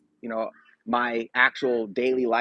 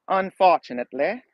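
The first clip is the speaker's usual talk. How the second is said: a light, vague put-on foreign accent on Unfortunately, pitch raised; unplaceable fake accent; 5 semitones higher, 3 dB louder than usual. Shown as a demonstration. Streamer jokingly tries an odd accent on Unfortunately. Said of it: "That's my very terrible accent".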